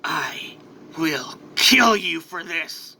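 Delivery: slow, whispery, halting menace; menacing threat. Acted. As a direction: hiss a vow word by word. Hero threatens villain.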